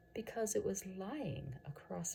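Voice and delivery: soft, slow, almost whispered; hushed, calm reveal of the danger; faint smile. Acted. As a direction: let it drop quietly with a small smile. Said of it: "A faint smile lights up".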